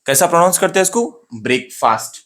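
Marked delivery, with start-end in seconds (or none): 1.3-2.2 BREAK-FAST as two full words, "ब्रेकफास्ट"; wrong pronunciation. Shown as a demonstration. Teacher shows students the common mistake, wrong pronunciation of "ब्रेकफास्ट". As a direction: say "ब्रेकफास्ट" with long "brayk" and long "faast". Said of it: "ये wrong pronunciation है उसका".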